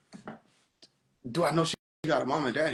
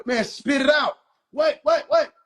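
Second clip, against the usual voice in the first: higher, louder mock yell at a slow talker; impatient shout; 8 semitones higher, 4 dB louder, slower than usual. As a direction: snap at them to spit it out. Irritated at stammering sibling.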